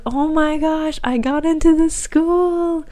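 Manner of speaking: high, drawn-out squeal of happy disbelief; overjoyed. Retold or imitated. Daughter hugging mom after getting into the school, overjoyed. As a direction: reenact the joy, voice lifted high and slow. Acting out joy at acceptance.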